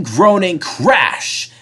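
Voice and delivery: ordinary delivery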